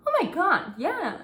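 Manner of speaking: ordinary delivery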